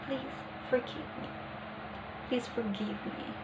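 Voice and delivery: very soft, embarrassed plea. Shown as a demonstration. Teacher showing how an embarrassed person asks forgiveness, very soft and embarrassed. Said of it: "embarrassed may stutter in a low pitch".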